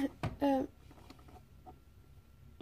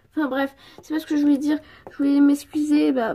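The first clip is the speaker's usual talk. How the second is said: ordinary delivery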